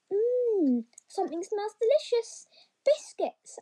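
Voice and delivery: high, eager little rabbit sniffing out treats; light child voice, hungry and thrilled; delighted sniffing. A narrator's reading. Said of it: "yelled the child".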